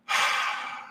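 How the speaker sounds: long, voiceless exhale; deep release breath. Shown as a demonstration. Streamer showing viewers how to release after tapping, long audible exhale. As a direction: let a big breath out slowly. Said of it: "take a big deep breath and then".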